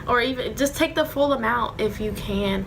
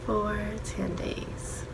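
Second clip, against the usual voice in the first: whispering; 7 dB quieter, much slower than usual. Real, not acted. Tearful mom away from baby.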